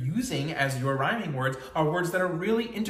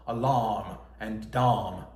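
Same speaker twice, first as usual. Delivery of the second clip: "alarm" and "dom" slow and lower in a British accent, with no R in "alarm", so both end in the same "ahm"; lower, slow British reading of "alarm and dom" to show the rhyme; 4 semitones lower, much slower than usual. Shown as a demonstration. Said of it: "like, a British dialect".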